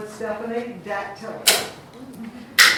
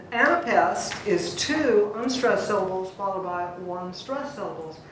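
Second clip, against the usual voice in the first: normal talking voice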